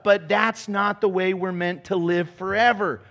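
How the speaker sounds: ordinary talk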